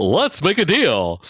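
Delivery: slow, drawn-out host announcement; game show host voice. Pretend. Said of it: "that game show voice".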